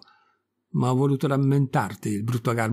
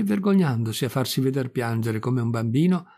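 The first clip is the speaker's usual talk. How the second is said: ordinary delivery, nothing marked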